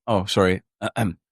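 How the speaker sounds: ordinary talk, nothing marked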